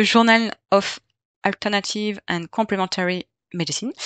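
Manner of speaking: English title in a careful French accent. Real, not acted. Presenter warns listeners about her accent, French-accented English.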